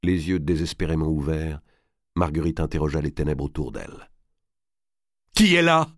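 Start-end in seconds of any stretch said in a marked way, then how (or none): 5.3-5.9 high, loud challenge into the dark; piercingly high, urgent cry; cried out. A narrator's reading. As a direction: shout it in alarm. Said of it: "cria-t-elle".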